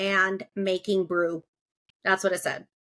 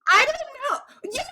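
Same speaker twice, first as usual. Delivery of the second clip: laughing; 3 dB louder than usual. Real, not acted. Laughing at gross brew ingredients.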